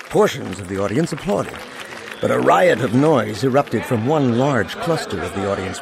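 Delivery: plain reading voice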